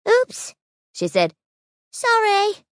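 put-on high, cheerful young bee; squeaky apologetic bee; little bee voice. Honey's voice.

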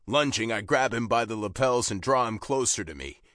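usual speaking voice

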